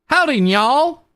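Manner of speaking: high, twangy Southern cowboy greeting. Pretend. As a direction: drawl it out like a cheerful cowboy. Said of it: "Cowboy voice".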